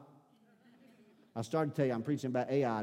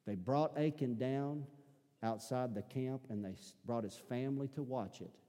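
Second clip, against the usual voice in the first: softer, lower preacher hush for the grim climax; low, soft storyteller voice for a solemn judgment; hushed sermon storytelling; 4 semitones lower, 6 dB quieter than usual. Acted. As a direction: draw them in quietly, pin-drop tension.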